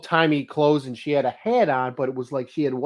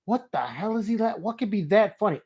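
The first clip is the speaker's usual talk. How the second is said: neighbors puzzled; 6 semitones higher than usual. Retold or imitated. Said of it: "I could hear 'em".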